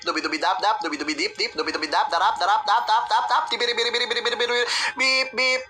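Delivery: scatted jeep horn, speeding up at the end; jeep horn imitation. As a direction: rattle it off like a jeepney horn. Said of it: "Sabi ng jeep".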